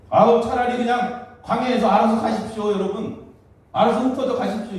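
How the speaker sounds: high, loud acted-out exasperation; fed-up exasperation. Moses tells the people to scatter on their own, exasperated.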